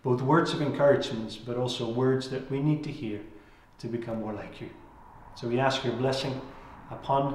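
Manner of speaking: soft, earnest prayer voice. Real, not acted. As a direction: pray quietly and humbly to the Lord. Opening prayer before sermon.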